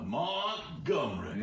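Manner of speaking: "Montgomery" stretched slowly in a laughing rural twang; drawn-out accent demo. Shown as a demonstration. Friends naming counties in a mock accent, slow. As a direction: drag out "Montgomery" in a put-on local accent. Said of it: "funny words to say in an accent".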